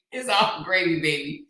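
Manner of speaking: ordinary delivery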